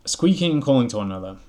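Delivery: loud, lively read-aloud narration; animated storybook narration; story reading. A narrator's reading. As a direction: read it out brightly to the listeners.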